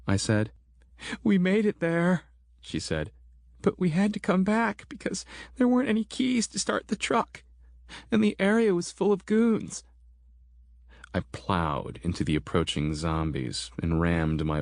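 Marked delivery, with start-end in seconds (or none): 1.2-9.8 high woman's voice, breathless with worry; upset Christine. Acted. Christine explains to the narrator why they came back, high and shaken. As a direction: rush the explanation, still shaken.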